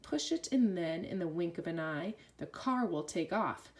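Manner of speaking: ordinary delivery, nothing marked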